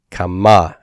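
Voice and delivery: "kama" ending on a strong, drawn-out MA; accent the final syllable of "kama"; stress on final syllable. Shown as a demonstration. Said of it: "accent that final syllable".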